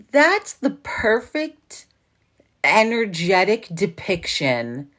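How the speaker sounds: high, drawn-out exclamation; emphatic agreement. Retold or imitated. Retelling own delighted reply to John, emphatic. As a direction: stretch it out high, then come down.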